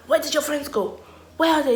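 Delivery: put-on high demanding girl voice. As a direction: demand answers from her. Girl demanding where friends went.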